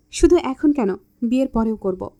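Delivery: usual speaking voice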